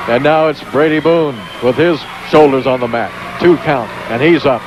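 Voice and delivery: high, fast ringside call of the match; excited play-by-play. Real, not acted. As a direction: call every move like a ringside announcer.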